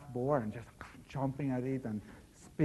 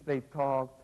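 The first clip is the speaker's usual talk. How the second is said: ordinary delivery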